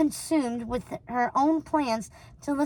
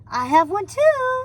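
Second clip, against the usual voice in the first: sing-song; 8 semitones higher, 5 dB louder, slower than usual. A narrator's reading. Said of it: "sing-song voice".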